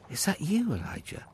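startled to see him; a servant surprised to meet the prophet on the road; surprised exclamation. A narrator's reading. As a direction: pitch jumps way up on you, then drops. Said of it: "Obadiah exclaimed".

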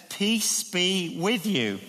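very slow, calm blessing; gentle greeting. Retold or imitated. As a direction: speak peace over frightened friends. Jesus greeting frightened disciples.

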